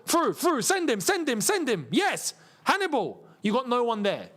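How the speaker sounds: high-pitched urging, words tumbling out; urgent excited urging. Real, not acted. Fan watching the match urges players forward, excited and pitched high. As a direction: urge the striker on as the chance opens.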